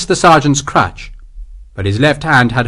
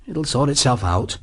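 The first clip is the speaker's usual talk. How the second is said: soft, gentle reassurance; calm him quietly; 6 dB quieter than usual. A narrator's reading. Sergeant leading Sharp away, quiet and calming. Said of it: "Green said quietly".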